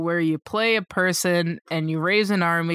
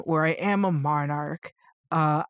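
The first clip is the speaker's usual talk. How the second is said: ordinary talk, nothing marked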